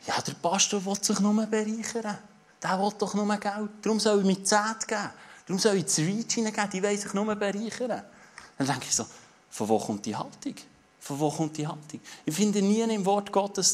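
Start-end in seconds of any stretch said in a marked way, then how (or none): 0.1-8.0 higher, mock-scornful grumbling; scoffing churchgoer. Churchgoer grumbles about the pastor and tithes, higher and scornful. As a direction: sneer like a doubter who thinks the pastor wants money. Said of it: "dass es plötzlich heisst".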